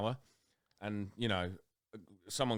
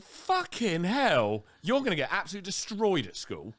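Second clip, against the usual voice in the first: exasperated; 9 semitones higher, 5 dB louder than usual. Retold or imitated. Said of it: "And I'm like".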